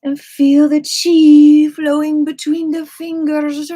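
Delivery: loud, slow, dramatic guru voice; booming and slow. Acted. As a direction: draw it out big and grand. Dramatic chi instruction.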